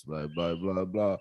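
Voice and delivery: quiet, lifeless monotone drone. Retold or imitated. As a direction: drone it like a dull instructor. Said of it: "this straight monotone voice".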